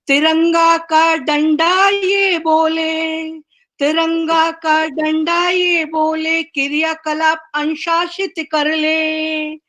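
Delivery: measured, sing-song recital with each line repeated; poem recitation. Acted. Poet reciting her tricolour poem to the online gathering, rhythmic recital. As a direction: lift the refrain like a patriotic anthem.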